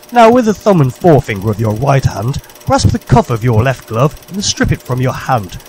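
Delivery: ordinary talk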